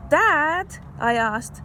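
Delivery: put-on bright read-aloud voice for kids; story reading. Teacher reading a story to kids, storybook voice. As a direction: read the picture book to children. Reads story with character quotes.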